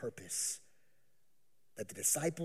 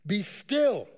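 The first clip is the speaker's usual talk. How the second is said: a firm, higher call to the sea; rebuking command; 8 semitones higher, 7 dB louder, much slower than usual. Acted. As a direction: command the storm to stop. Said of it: "rebuked the wind and said".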